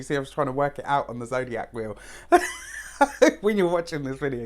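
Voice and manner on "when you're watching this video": laughing through the words. Real, not acted. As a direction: laugh it off, embarrassed. Laughing at own mind blank.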